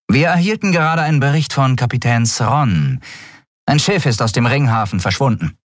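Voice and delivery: höhere, militärisch knappe Meldestimme; sachliche Offizierin. A narrator's reading. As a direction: Meldung an den Vorgesetzten. Offizierin Shia meldet dem Admiral. (erste Offizierin meldet dem Admiral sachlich, höher)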